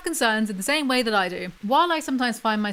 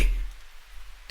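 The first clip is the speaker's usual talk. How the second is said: soft laugh; 7 dB quieter than usual. Real, not acted. Laughing at own joke.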